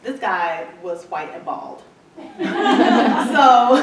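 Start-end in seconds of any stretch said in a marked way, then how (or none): 2.2-3.3 loud high real laugh; burst of laughter. Real, not acted. Laughing about the bald buyer.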